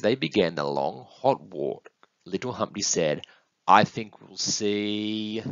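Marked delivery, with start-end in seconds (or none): 3.7-5.4 very slow, last word stretched out; suspenseful guess. Acted. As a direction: draw it out while thinking up an answer. Little one guesses with suspense.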